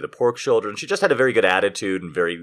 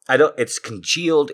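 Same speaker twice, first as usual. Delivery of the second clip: ordinary delivery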